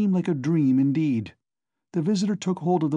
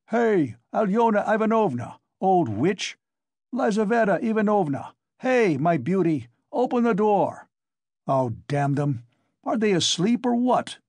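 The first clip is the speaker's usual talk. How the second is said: yelling through a door; 7 semitones higher than usual. A narrator's reading. Coke hollering at the locked door, impatient. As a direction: hammer the door with your voice.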